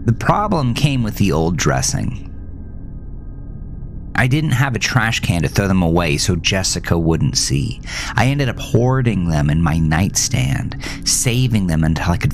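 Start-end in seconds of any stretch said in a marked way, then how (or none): none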